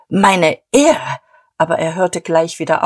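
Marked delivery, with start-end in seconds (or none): 0.1-1.2 lauter, empörter Ausruf; plötzlich nach Luft schnappend aufbrausen; aufschnappend. A narrator's reading. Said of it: "Er schnappte". (empört und laut aufgebracht)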